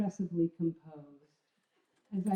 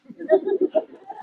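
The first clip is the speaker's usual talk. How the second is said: a loud rising burst of laughter; laughing; 12 semitones higher, 7 dB louder than usual. Real, not acted. Narrator laughing at the dogs' chaos. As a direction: let the laugh climb up and out.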